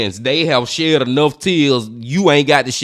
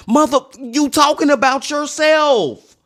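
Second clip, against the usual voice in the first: much higher, disbelieving retort; incredulous outburst; 11 semitones higher than usual. Real, not acted. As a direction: throw it back at him in disbelief. Outraged at the killer's hypocrisy.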